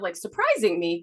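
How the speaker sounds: ordinary talk, nothing marked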